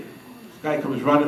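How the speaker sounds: ordinary talk, nothing marked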